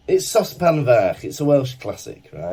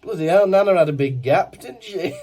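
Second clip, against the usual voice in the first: ordinary talk, nothing marked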